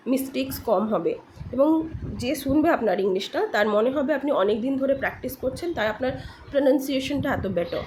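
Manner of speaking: usual speaking voice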